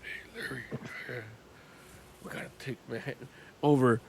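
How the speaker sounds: very soft, low gravelly mumble; barely audible rumble from deep down; whispering Kingpin. Retold or imitated. Showing how quietly Kingpin talks, hushed growl. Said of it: "for perspective, it's like".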